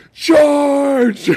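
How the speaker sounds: high, drawn-out cry of attack; hammed-up call to charge; mock battle cry. Host imitates Snake driving the Panzer, mock battle cry.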